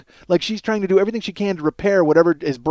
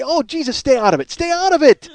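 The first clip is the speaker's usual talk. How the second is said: high, loud anguished plea; strained high cry of despair; pleading cry; 10 semitones higher, 3 dB louder than usual. Real, not acted. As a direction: beg her to stop, voice cracking. Begging wife to stop.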